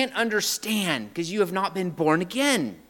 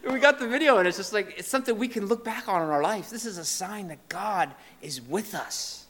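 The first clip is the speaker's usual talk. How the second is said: ordinary talk, nothing marked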